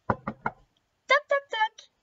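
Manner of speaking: high, loud knocking noises; knock-knock sound effect. Acted. Little girl knocking at Grandmother's door, high loud toc-toc.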